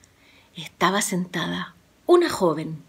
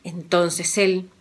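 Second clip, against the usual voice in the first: usual speaking voice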